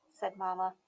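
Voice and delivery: usual speaking voice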